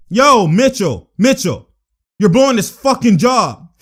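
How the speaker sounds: loud, drawn-out angry call. Real, not acted. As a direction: yell at him for messing up. Yelling at Mitchell over the play.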